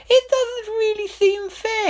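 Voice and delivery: high, slow, loud royal voice; loud king. Acted. King pressing Herringbone, high and loud. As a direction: insist loudly like a vain king. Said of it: "King said Fred loudly".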